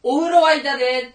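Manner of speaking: loud, high call across the house; mock family call. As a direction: call from another room that the bath is ready. Family member calls bath is ready.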